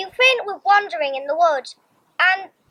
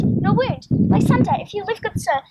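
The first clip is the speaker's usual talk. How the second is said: ordinary delivery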